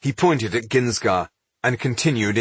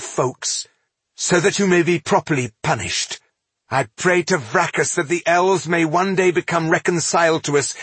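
higher, formal condemnation; indignant dwarf denouncer; 7 semitones higher than usual. A narrator's reading. Dwarf threatens the self-made king with impeachment, indignant. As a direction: pronounce judgment with force.

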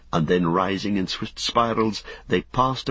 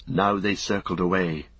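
ordinary delivery, nothing marked

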